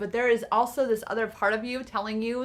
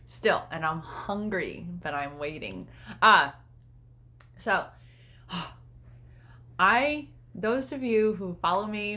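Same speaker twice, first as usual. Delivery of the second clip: ordinary talk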